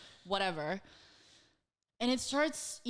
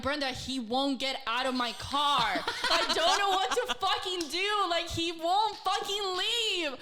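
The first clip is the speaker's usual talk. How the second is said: higher, pleading voice with a laugh in it; retold desperation; 7 semitones higher, 3 dB louder than usual. Texting roommate for help with unwanted passenger, mock-desperate. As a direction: play up the helpless frustration. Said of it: "I am desperate now".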